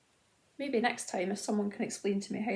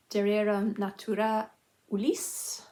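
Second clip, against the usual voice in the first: amateur attempt at French pronunciation; dodgy French accent; 4 dB louder than usual. Shown as a demonstration. Knitter reads a yarn name on camera, dodgy French accent. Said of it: "That's my dodgy French accent".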